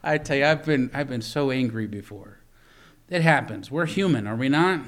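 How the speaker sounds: normal talking voice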